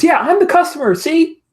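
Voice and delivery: put-on very high, loud girlish squeal; shrieky excited young customer; eager fan. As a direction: pipe up loudly and proudly. Girl proudly announces herself as customer.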